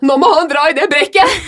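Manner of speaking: high, loud panicked shout; retold panicked scream. To the bus driver on the hill, a high panicked yell. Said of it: "skriker jeg i den bussen".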